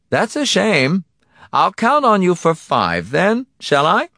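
high, loud, falsely pleasant villain; indulgent villain. Acted. Donati brushing off a caller's pleas, indulgently. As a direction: toy with the victim, pitched high and loud.

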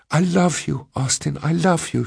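high-pitched wailing plea; wailed. A narrator's reading. Cowering woman declares love to Austin, wailing. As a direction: wail it out, pitched well up. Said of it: "she wailed".

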